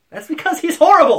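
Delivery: high, loud exasperated outburst; real yell. Real, not acted. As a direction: yell it in protest at the teasing. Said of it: "You yell all the time".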